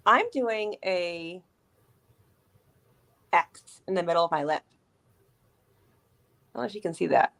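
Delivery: talking while lining her lips; while putting on lipstick. Real, not acted. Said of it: "It's hard to talk and do lip liner".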